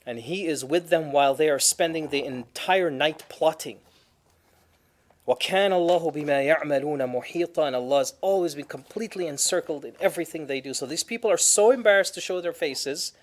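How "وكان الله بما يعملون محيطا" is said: fast, flowing chant of the verse; Quran recitation. Acted.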